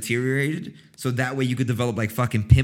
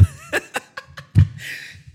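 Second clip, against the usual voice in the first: breathless high laughing cackle; airy cackle. Real, not acted. Cracking up at her own shoulder-grabbing habit, airy high cackle.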